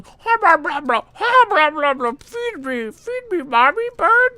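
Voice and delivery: put-on very high squeaky chirping baby bird begging. Baby bird begging its mommy bird for food, high squeaky chirps. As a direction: squeal for food like a hungry chick. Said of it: "like a baby bird just going".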